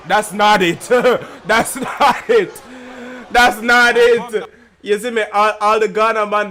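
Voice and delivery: shouting it out, laughing; real laughing shout; loud outburst. Real, not acted. Streamer reacting loudly to battle.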